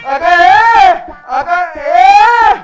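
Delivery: excited high call to the wife; shouting. Acted. Husband calls wife about the newspaper advertisement, shouting. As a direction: holler the news through the house, rising to a yell. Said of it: "कशाला ओरडताय?".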